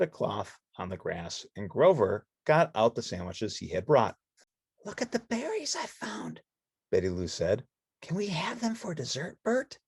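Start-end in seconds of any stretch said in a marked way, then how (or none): 4.8-9.8 put-on high, soft girlish voice; Betty Lou voice. Betty Lou pointing to the bird, soft and high.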